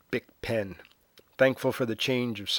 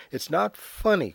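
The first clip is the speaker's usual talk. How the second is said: icy; 7 semitones higher than usual. Acted. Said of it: "Cartier says icily".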